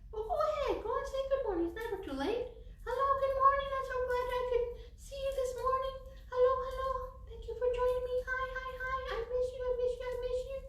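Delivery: high bubbly greeting voice. Real, not acted. Teacher greeting students warmly and brightly.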